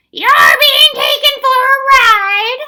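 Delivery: very high, very loud, slow shriek. A narrator's reading. Wiffle bird shrieking down at the crew, very high and loud. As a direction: shriek it from the mast top like a wild bird. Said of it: "She shrieked".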